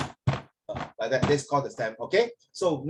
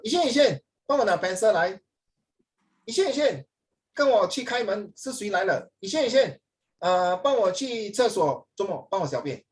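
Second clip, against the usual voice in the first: higher, nagging call of the name; annoying sister calling; 6 semitones higher than usual. Retold or imitated. Teacher mimicking a sister who keeps calling Yixuan. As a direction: whine the orders like a pestering sibling. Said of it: "then 你说".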